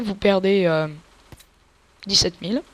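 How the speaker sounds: normal talking voice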